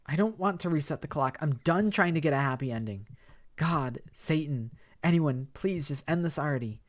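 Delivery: high, loud desperate begging; pleading girl. Acted. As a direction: beg anyone to end it. Karma begs to end it.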